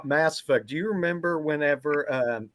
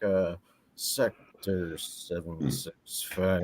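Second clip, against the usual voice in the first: slow, softer mumble; mumbled code; 6 semitones lower, 6 dB quieter, much slower than usual. Retold or imitated. Retelling Shepard mumbling the code in Mass Effect. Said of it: "he just starts mumbling".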